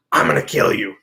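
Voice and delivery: low threat. Retold or imitated. John threatening, voice dropped.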